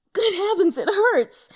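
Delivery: loud, high yelp of pain; cry of pain. Acted. Injured young man yelping in pain. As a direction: yelp out as it stings.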